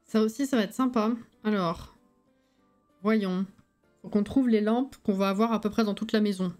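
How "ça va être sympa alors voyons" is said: ordinary talk, nothing marked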